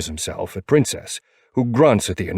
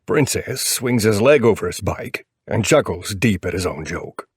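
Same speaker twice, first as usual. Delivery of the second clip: ordinary delivery, nothing marked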